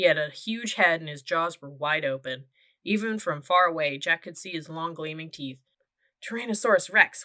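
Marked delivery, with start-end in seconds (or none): none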